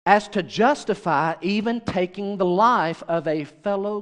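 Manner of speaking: slow, measured reading with pauses between phrases; careful, emphatic reading. Real, not acted. Preacher reads a technical quote to the congregation, careful and emphatic. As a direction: weigh each phrase of a dense quote. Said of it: "read it with the emphasis on the right syllable".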